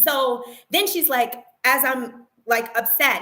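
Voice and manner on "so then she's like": ordinary talk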